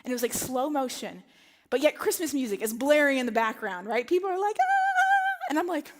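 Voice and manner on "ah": very high happy shriek; cheerful high squeal. Retold or imitated. Holiday travellers greeting each other, happy high squeal.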